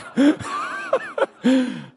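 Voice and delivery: high, breathy burst of laughter; laughing at the strange moment; real laugh. Real, not acted. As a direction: laugh with relief. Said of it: "It's gotta work".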